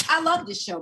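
ordinary talk, nothing marked

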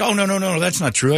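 high, hurried mock-panicked protest; flustered denial. Embarrassed man denying he is a fan, flustered.

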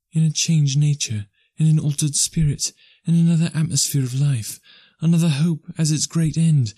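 put-on a fair young girl letting her lover go; young woman's voice. A narrator's reading. Belle, young woman voiced high.

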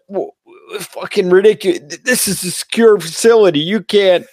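imitating outraged guard. Guard lecturing driver about secure facility, mock outrage. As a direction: play the huffy sentry. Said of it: "And he's like".

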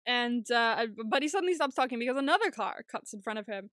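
ordinary talk

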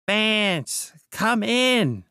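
high-pitched summoning call; crying out. A narrator's reading. Mister Wilde summons the crouching man, crying out. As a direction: call someone in, pitched well up. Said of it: "cried Mister Wilde".

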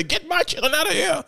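high mock call to get the kids out; first take, a high imitated plea; retold yell. Father in handcuffs calls out to officers, high imitated plea.

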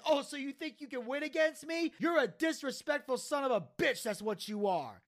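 high mock outrage dropping at the end; indignant comeback. Taz twists Joey's words into an insult, indignant. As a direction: turn it round, then condemn him. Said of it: "turning everything around on him".

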